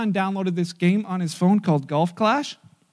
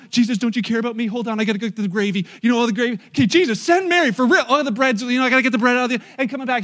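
hurried, higher-pitched fussing; frantic, raised-voice busy chatter; flustered Martha; 4 semitones higher, 5 dB louder, faster than usual. Acted. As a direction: rattle it off while rushing about. Acts out flustered, busy Martha complaining.

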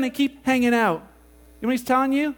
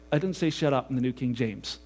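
low, prim voice of a shocked listener; mock shocked churchgoer; 10 semitones lower, 6 dB quieter than usual. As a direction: play the prissy churchgoer who objects.

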